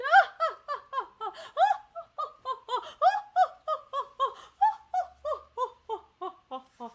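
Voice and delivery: high, loud hammed-up witch laugh; long gleeful villain cackle; evil witch cackle. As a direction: cackle like a wicked enchantress. Enchantress cackle after line.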